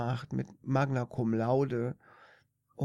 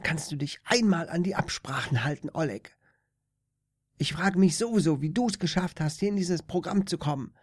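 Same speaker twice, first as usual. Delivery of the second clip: nasty little dwarf; 4 semitones higher, faster than usual. Acted. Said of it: "der widerliche kleine Zwerg".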